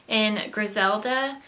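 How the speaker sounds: ordinary delivery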